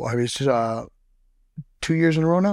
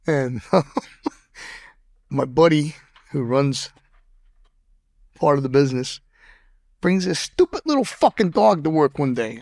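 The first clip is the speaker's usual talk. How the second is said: usual speaking voice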